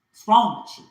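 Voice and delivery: furious what's wrong with you; shouted scolding. Acted. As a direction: yell it at them in anger. Ancestors scolding, repeated loud.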